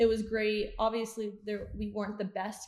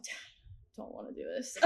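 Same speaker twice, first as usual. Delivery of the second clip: annoyed whine; 7 dB quieter than usual. Retold or imitated. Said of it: "like, so annoyed".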